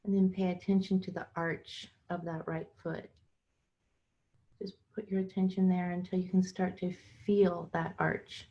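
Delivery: unhurried guided meditation with long pauses. Acted. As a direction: guide listeners into their bodies phrase by phrase, leaving room between.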